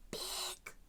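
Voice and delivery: high, soft whisper. Acted. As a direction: hush while peeking in. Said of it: "whispered Gloom".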